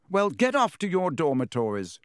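high, officious order; stern. A narrator's reading. Prefect ordering younger students to bed, sternly. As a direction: send them off with prefect's authority. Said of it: "said Percy sternly".

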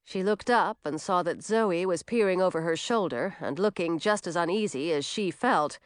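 ordinary delivery, nothing marked

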